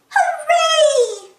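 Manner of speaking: put-on very high, loud little girl cheer. Peppa gets her teddy prize, in a very high loud cheer. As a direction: whoop with delight. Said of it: "cheers Peppa".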